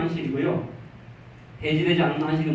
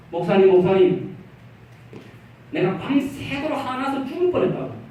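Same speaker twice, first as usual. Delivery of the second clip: upset complaint; 6 semitones higher than usual. Retold or imitated. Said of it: "막 화를 내요".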